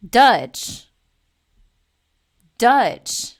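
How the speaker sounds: loud, falling "Dutch." dripping with contempt; emphatic contemptuous repetition; loud disgust. Real, not acted. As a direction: hammer the word with scorn.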